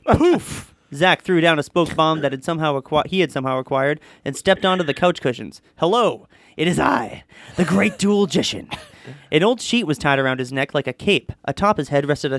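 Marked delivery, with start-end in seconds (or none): 0.1-0.7 high, airy burst of a word; magician's poof. A narrator's reading. Magician throwing down a smoke bomb, high poof. 5.8-8.7 slow, pompous stage introduction; grand magician. A narrator's reading. Crazed magician greeting his audience from the couch, grand showman.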